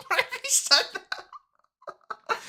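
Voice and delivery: very high and slow, breaking up; squeaky high laugh-voice. Real, not acted. Streamer cracking up, squeaking very high and slow.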